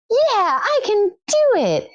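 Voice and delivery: very high, drawn-out squeak; slow, high-pitched comic declaration; mock tearful resolve. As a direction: act out newfound resolve in a tiny high voice. Retelling response to midwife's encouragement.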